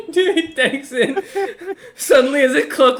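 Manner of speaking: narrating through laughter; laughing. Real, not acted. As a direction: crack up mid-sentence.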